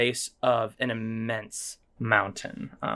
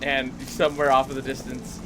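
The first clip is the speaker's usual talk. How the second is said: ordinary talk, nothing marked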